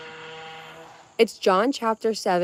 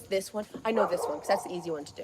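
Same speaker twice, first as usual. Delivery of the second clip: soft meme quote; 10 dB quieter, faster than usual. Retold or imitated. Spider-Man meme quote.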